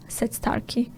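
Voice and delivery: ordinary delivery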